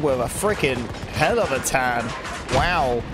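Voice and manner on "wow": ordinary talk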